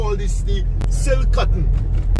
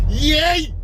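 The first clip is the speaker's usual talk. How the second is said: put-on sudden high bawl from the baby; a spirit baby yelling into his ear; baby's bawling cry; 7 semitones higher than usual. Spirit baby bawls into the man's ear, high and sudden. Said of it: "by bawling".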